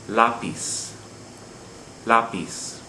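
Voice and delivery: LÁpiz, weight on the first syllable; second-to-last syllable stress. Shown as a demonstration. Teacher posing the next word, lápiz stressed on LÁ. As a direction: stress "lápiz" on LÁ, LAH-pees. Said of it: "We're stressing the second to last syllable".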